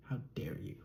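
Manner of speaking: hushed, playful how dare you; mock-offended whisper; whispered mock outrage. Mock outrage at nasal remark.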